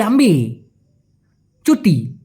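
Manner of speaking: "আম্বি" with the final l dropped; shortened slang word. Shown as a demonstration. Teacher showing the dropped sound, in slang pronunciation. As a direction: stop at the vowel in "আম্বি". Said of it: "আমবিল না বলে".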